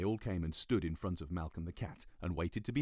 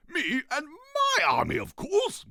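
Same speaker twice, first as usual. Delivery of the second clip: loud, high, slow declaration; a grand king proclaiming; pompous king; 13 dB louder, slower than usual. Acted. King voiced.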